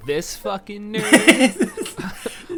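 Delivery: high, loud sneering heckle through laughter; high jeering bully voice; mocking heckler. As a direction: taunt the teacher's class. Mocking intruder voice.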